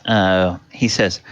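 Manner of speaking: ordinary talk, nothing marked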